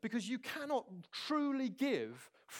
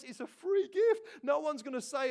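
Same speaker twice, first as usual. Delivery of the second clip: much higher and louder, slow; excited exclamation; 7 semitones higher, 6 dB louder than usual. Real, not acted. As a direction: burst out with joy. Preacher exclaims.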